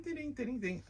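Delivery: high sung dings like xylophone bars; ding it out like striking a xylophone; playful high ding notes; imitated xylophone dings. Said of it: "you ding".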